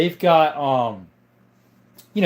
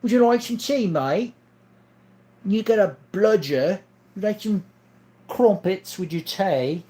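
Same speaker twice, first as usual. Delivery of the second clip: higher, put-on British accent; exaggerated British lilt, pitched up; 5 semitones higher than usual. Shown as a demonstration. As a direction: offer tea in mock British. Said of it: "sounds British to me".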